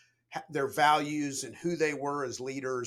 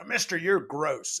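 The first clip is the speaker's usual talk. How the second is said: louder, drawn-out mock reprimand; higher, slower telling-off, acted out; scolding upperclassman; 4 semitones higher, 6 dB louder, slower than usual. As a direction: imitate an upperclassman dressing down a plebe. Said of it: "correcting my appearance".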